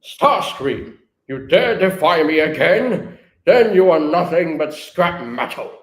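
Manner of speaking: loud, outraged villain threat; slow, booming menace at a traitor; imperious, hammed-up fury; Megatron. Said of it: "And Megatron goes".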